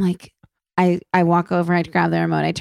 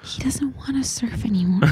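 mock-dramatic whisper, higher; 4 semitones higher, 4 dB quieter than usual. Wife whispering to husband about their son, mock-dramatic whisper. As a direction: hush it like a soap-opera confession.

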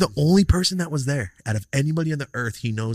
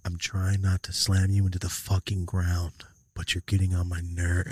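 restrained threat; 6 semitones lower, 4 dB quieter, slower than usual. Retold or imitated. Retold restrained threat before losing it.

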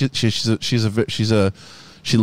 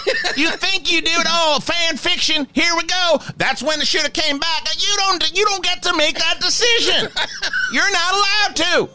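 higher, ranting troll voice, insulting the fan; Professor Crow rant; 4 semitones higher than usual. Pretend. Professor Crow berating a Star Wars fan, higher ranting voice. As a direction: tear into him like a mean cranky professor. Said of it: "Professor Crow needs its own Patreon".

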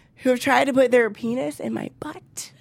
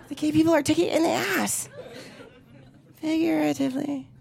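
usual speaking voice

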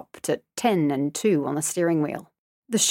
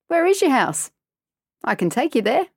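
lightly voiced friendly stranger; 7 semitones higher than usual. A narrator's reading.

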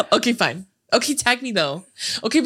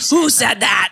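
loud, gruff monster shout; 2 semitones higher, 3 dB louder than usual. Retold or imitated. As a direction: bellow it like a monster. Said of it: "in a monster voice".

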